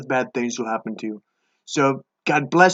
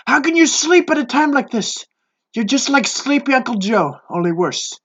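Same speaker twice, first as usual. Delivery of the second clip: yelling captain; 7 semitones higher than usual. Acted. Captain yells at sleeping Jonah.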